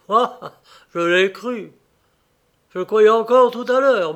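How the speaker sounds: éclat douloureux, plus fort et plus haut; désillusion douloureuse. A narrator's reading. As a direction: laisser éclater la déception. Il crie sa désillusion. (cri de cœur déçu)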